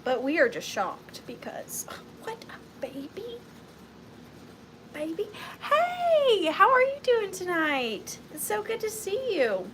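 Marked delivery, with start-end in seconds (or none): 2.2-5.3 high, disbelieving gasp of surprise; mock shock. 5.6-9.7 high, bright hostess welcome; cheery greeting. Real, not acted. Greeting live viewers.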